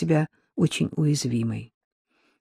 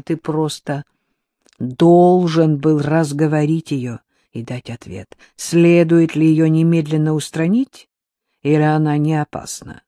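plain reading voice

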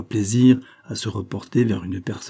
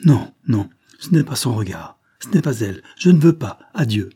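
refusing outburst; 6 dB louder than usual. Acted. Vibert rejecting the woman, loud and desperate. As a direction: push her away with your voice, growing into a cry.

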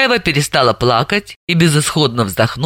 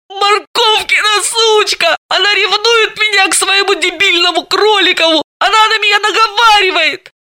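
tearful whine. Acted. Suspect whining to detective about her rival, tearful whine. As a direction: whimper it out like a wronged woman. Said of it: "плаксиво проныла".

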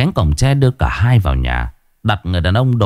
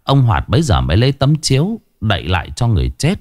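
usual speaking voice

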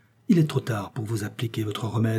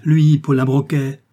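loud, slow, raised exclamation; crying out; 4 semitones higher, 8 dB louder, slower than usual. A narrator's reading. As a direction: hurl each word out slowly. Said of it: "s'écria alors Funghetti". (s'écrier fort, en détachant les mots)

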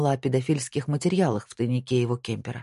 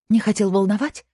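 high, raised-voice incredulous question; shouted indignant echo; 8 semitones higher, 4 dB louder than usual. A narrator's reading. Wife echoes husband's excuse, shouting indignantly. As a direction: throw his words back at him. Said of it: "закричала она на него".

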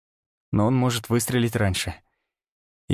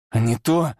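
ordinary delivery, nothing marked

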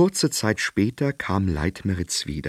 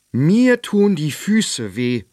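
hohe, laute, langsame Königsstimme; klagender König; 8 semitones higher, 6 dB louder, slower than usual. Acted. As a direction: ein König, der jammernd Mitleid fordert. König klagt über Füße. (König beklagt sich beim Zauberer, hoch und jammernd)

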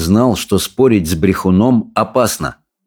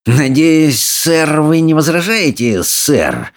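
high-pitched, sneering mock politeness; threatening bully, voice pitched up; menacing hiss; 7 semitones higher, slower than usual. A narrator's reading. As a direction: hiss it with false deference. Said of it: "прошипел Сопляк".

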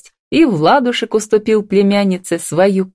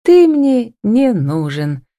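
slow, higher, airy dismissal; carefree drawn-out brush-off; flippant; 6 semitones higher, slower than usual. A narrator's reading. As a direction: toss it off lightly. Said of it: "добавила легкомысленно".

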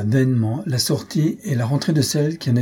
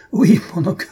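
high, lightly acted burst of mirth; bursting into laughter; 7 semitones higher, 4 dB louder than usual. A narrator's reading. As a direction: answer through a mock fit of laughter. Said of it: "Pistache pouffa de rire". (pouffant de rire, joué)

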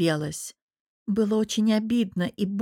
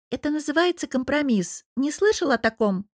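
higher-pitched, challenging wife; arch questioning; 6 semitones higher than usual. A narrator's reading. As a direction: ask it pointedly, pitched up. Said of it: "вскинув бровь, поинтересовалась".

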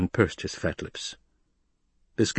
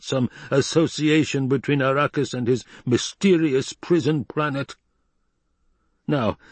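higher, insistent villain voice; imperious Baron; 6 semitones higher than usual. Acted. Baron demands an explanation from Hawat, imperious. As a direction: play a demanding Baron pressing his adviser.

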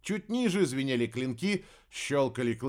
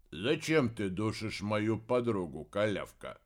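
low dragon voice; 4 semitones lower than usual. A narrator's reading. Dragon speaks.

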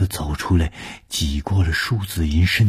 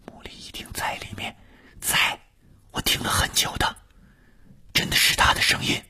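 urgent breathy whisper; whispering in ear; 3 dB quieter than usual. A narrator's reading. Maid at her mistress's ear by the door, urgent whisper. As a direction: whisper insistently, close to the ear. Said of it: "凑在舒子英的耳边说".

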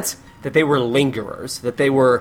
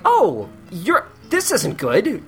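rising, mock-scolding voice; talking to a bug; 7 semitones higher than usual. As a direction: scold the bug, pitch climbing. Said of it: "I'm like".

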